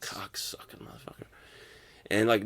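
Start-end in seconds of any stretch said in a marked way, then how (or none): 0.0-1.3 voiceless, barely audible insult; muttered curse under breath. Retold or imitated. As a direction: whisper the curse to yourself. Inner reaction to coach's apology.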